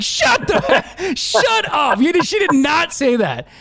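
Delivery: giddy disbelief, laughing through the words. Retold or imitated. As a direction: squeal it like you can't believe it. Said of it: "And I was like".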